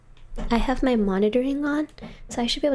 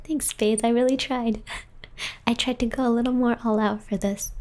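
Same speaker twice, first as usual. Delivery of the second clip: normal talking voice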